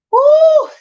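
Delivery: piercing high whoop of shock; loud woo. Real, not acted. Shocked by Chicago parking costs, a loud high whoop. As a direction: let out a huge high woo.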